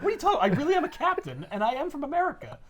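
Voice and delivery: mock-indignant high voice; high-pitched protest. Captain insisting he really is one, high mock protest. Acted Cap protesting the taunt.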